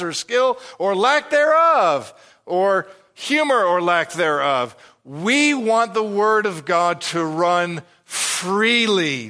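high, forceful sermon delivery; impassioned preaching. Real, not acted. Pastor declaring the church's goal to God's people, impassioned preaching. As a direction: drive it home, stretching the key words.

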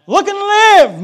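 high, loud preacher's cry; exhorting shout. Acted. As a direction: call it out as an urgent appeal. Preacher cries out the hymn's appeal.

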